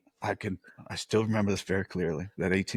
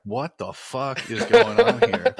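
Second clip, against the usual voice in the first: bewildered outburst; 10 semitones higher, 8 dB louder, slower than usual. Retold or imitated. Retells his bewilderment amid gunfire.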